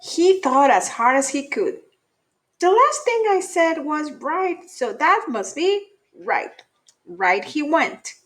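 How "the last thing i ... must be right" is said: higher, pleased with his own logic; bunny deciding on the wrong path; lightly voiced bunny. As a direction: reason it out proudly, a little silly. Buddy reasons aloud.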